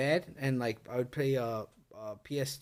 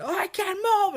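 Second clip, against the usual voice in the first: high pitched plea imitating sleep talk; high strained cry; 13 semitones higher than usual. Quoting himself talking in his sleep, a high strained plea. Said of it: "he said".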